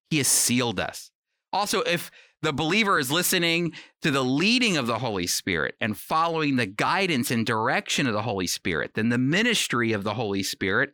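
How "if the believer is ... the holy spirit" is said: ordinary talk, nothing marked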